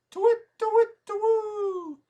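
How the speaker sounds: very high, loud, slow owl call; owl hoot. Acted. Owl calling the fourth knight, high loud hoot.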